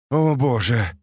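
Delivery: ordinary delivery, nothing marked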